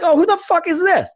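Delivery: high mock voice breaking into laughter; mock jaguar laughing. Jaguar spotting the intruder, high laughing mock voice. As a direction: squeal it out, laughing at your own bit. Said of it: "I literally heard him going like".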